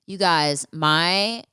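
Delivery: usual speaking voice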